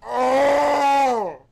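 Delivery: loud high cry of dismay; anguished shout. Real, not acted. Gamer crying out at the vote result, anguished.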